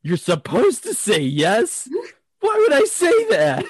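high, squeaky disbelief; incredulous. Retold or imitated. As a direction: sound baffled at yourself. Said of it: "I was like".

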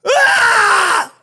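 sudden high shriek, loud; a wife's startled scream; wife's panicked shriek. Retold or imitated. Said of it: "That's her".

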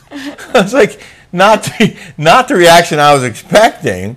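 laughing through the words, high and loud. Real, not acted. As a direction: laugh it out in disbelief. Laughing while retelling reaction.

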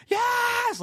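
high cheering yes; excited yes. Retold or imitated. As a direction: celebrate the win. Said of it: "We're like".

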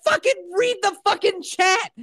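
high, exasperated demand; reenacted rage. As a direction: order them in theatrical outrage. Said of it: "I was just reenacting my rage".

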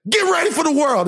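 high, stern dad; imitating dad. Retelling his dad's advice, mock stern. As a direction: bark it as tough love. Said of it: "He'd say".

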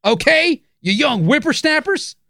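put-on high, crotchety old-timer voice; old prospector. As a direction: scold the kids like an old coot. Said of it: "Okay, Coomer".

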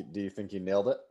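usual speaking voice

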